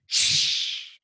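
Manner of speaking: mock teacher's shush. Kid imitates his teacher silencing them, shushing. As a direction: hiss a sharp shh at the class. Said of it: "she's like".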